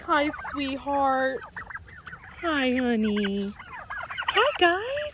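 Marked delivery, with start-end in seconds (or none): 0.0-3.5 tender. Real, not acted. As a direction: coo gently at the birds. Cooing to the birds.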